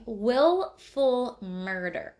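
WILLFUL drawn out, slow and weighty; slow stress. Acted. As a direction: lean hard on "Willful", stretching it out slowly. Ominous reading of the charge.

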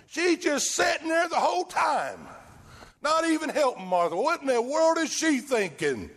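high-pitched, exasperated complaint; hammed-up indignant sister; mock indignation. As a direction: protest in mock outrage. Acting out indignation at idle sister.

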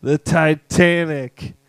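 slow, loud, deliberate; deadpan punchline. Retold or imitated. Chinese guy in the joke answering, slow loud deadpan. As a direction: hand it over slow and heavy. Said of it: "the Chinese guy goes".

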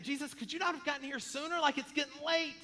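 high-pitched acted complaint, rising in force; exasperated Mary. Mary to Jesus arriving late, exasperated complaint. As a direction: plead in hurried, mock exasperation. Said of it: "she's gotta be thinking".

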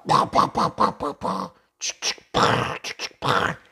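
breathy pa pa gun sounds; gunshot noises. Pretend. Teacher making water gun shots for learners. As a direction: spit out quick pretend gunshots.

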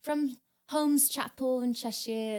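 high, put-on British accent; fake British accent. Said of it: "talking in a British accent to someone".

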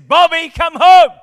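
loud, high, slow call to a lost son. Retold or imitated. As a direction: cry out into the distance for him. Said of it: "and he says".

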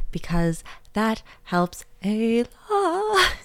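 slow, stretched pleading read; drawn-out plea. Pretend. Host begging listeners for reviews, slow and stretched. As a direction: drag the words out like a begging host.

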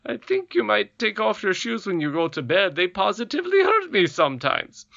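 a hint of a henpecked husband; lightly voiced goblin king. A narrator's reading. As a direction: goblin king asking about her feet.

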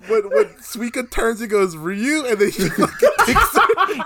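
high laughing through words; can barely talk from laughing. Real, not acted. Laughing hard retelling.

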